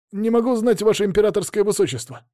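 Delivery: high, quick by-the-book reply; startled soldier answer. Acted. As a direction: blurt it out like a scared private. Soldier snaps to attention. (испуганный солдат, быстро и звонко)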